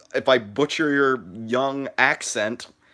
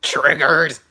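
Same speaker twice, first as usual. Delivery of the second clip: loud, higher-pitched meme shout; mock outburst; 5 semitones higher, 8 dB louder than usual. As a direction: burst out in mock outrage. Mock meme outburst after pause.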